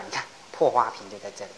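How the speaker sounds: ordinary talk, nothing marked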